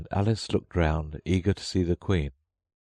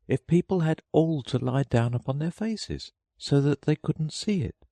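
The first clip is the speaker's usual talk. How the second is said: high-pitched Alice puzzling it out; Alice thinking; 10 semitones higher than usual. A narrator's reading. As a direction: muse to yourself as Alice, pitched up. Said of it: "thought she".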